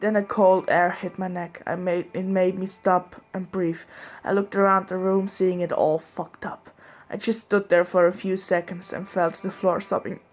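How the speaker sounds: ordinary delivery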